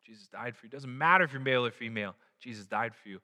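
ordinary talk, nothing marked

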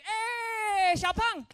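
high, loud, long drawn-out hollered greeting; barber's greeting. Retold or imitated. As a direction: holler it like the barber hailing a regular. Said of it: "the barber shop and be like".

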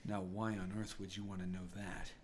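low, soft, guarded question; suddenly sober. Acted. Sobered man questioning the party, low and serious. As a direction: drop low and quiet, all business now. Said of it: "he sobers up rather quickly".

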